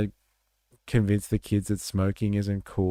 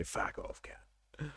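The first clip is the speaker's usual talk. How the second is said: whispered Aussie; 7 semitones higher, 14 dB quieter, slower than usual. Retold or imitated. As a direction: breathe the Australian insult as a whisper. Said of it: "how they say".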